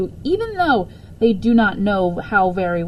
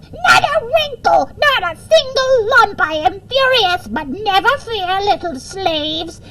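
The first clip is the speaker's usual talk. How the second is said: very high, loud, slow shrieking evil queen; hammed-up screeching queen, raging at the slaves; furious queen's yell; 12 semitones higher, 7 dB louder, slower than usual. A narrator's reading. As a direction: shriek like a wicked queen cheated of her prey. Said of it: "She yelled".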